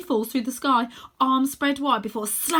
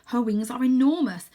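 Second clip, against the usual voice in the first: usual speaking voice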